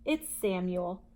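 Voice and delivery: usual speaking voice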